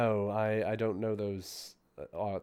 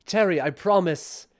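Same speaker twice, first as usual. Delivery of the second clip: reassuring the familiar; 8 semitones higher, 6 dB louder than usual. Pretend.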